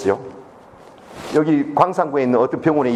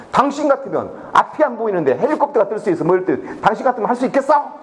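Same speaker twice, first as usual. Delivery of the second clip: higher-pitched indignant retort, imitated; retold angry outburst; 4 semitones higher than usual. Rescue chief snaps back at the official, retold angry outburst. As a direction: act out someone flaring up in anger, building higher. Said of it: "이 사람이 화를 버럭 내는 거예요".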